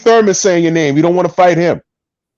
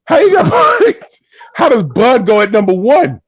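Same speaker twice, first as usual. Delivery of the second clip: much higher, louder disbelieving yell; incredulous shout; 7 semitones higher, 3 dB louder than usual. Real, not acted. Host yells at co-host over Bud at number one, incredulous shout. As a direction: shriek it in disbelief. Repeats question, yelling in disbelief.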